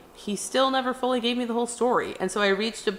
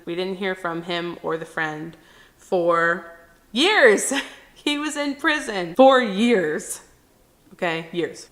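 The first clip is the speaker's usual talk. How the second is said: ordinary talk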